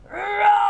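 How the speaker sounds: loud, high, long roar; big dinosaur roar. Acted. Dinosaur opening up again, loud long roar. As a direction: open up and roar at full voice. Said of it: "he opens up again".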